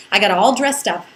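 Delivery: plain reading voice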